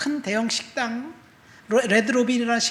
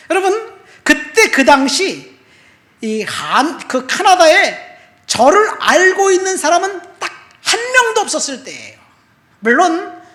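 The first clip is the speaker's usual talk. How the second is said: louder, higher preaching, stretched out and urgent; preaching at full force; 8 semitones higher, 10 dB louder than usual. Acted. Preacher stirring the congregation, loud and fervent. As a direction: drive the testimony home to the congregation.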